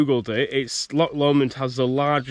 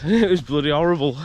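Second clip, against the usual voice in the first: ordinary talk, nothing marked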